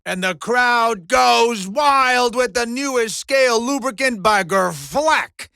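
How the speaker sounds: loud, rapid-fire sponsor plug; shouted ad read. Acted. Arena host plugs a dandruff product, loud hype. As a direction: belt it out like an arena pitchman.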